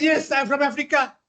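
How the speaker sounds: cheerful airman. Retold or imitated. American airman's reply, high.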